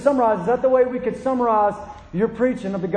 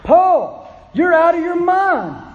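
higher, louder outburst at Paul; 7 semitones higher, 5 dB louder than usual. Retold or imitated. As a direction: interrupt Paul with a loud shout. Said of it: "Festus said with a loud voice".

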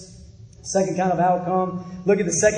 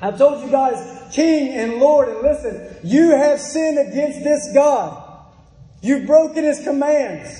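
raised, punchy pulpit delivery; forceful preaching; 5 semitones higher than usual. Acted. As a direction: press each charge home in a raised preaching voice.

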